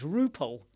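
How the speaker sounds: "Rupal" said without the D; "Rupal" as roo-pal; mock silent-D "Rupal". Shown as a demonstration. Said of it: "Is it a silent D".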